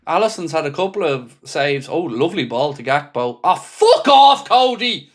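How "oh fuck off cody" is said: slow, loud and high outburst of rage; furious shout. Real, not acted. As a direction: let the swear explode at the player. Fan furious at missed control.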